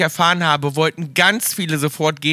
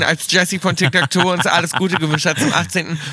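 ordinary talk

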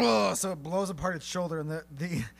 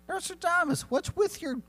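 high-pitched, slow, teasing voice for a nosy villager; a high, slow put-on voice pestering Nostradamus about his gear; high mock questioner; 11 semitones higher, 4 dB louder than usual. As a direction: squeak the question up high, slowly.

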